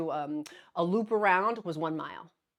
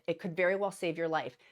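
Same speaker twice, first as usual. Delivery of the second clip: ordinary talk, nothing marked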